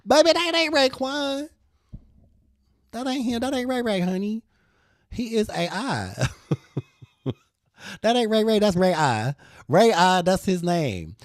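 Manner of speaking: high, loud teasing put-down; sassy correction. Acted. Telling a fooled shopper the man is AI, high and sassy. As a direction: set her straight with attitude.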